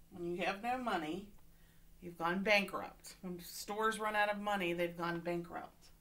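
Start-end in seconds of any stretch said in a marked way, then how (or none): none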